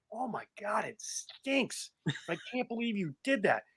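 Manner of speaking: disgusted aside. Retold or imitated. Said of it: "Boris is like".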